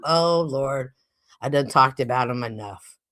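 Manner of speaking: normal talking voice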